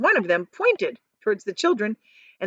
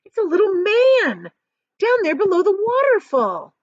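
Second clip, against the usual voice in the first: put-on much higher, louder frantic girl; excited screaming; 12 semitones higher, 5 dB louder than usual. A narrator's reading. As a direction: shriek each discovery, pointing wildly. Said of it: "she screamed".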